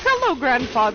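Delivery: loud, amused hello; mock loud greeting. Player greeting the grandfather back to life, loud and amused. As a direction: call out a cheeky loud greeting.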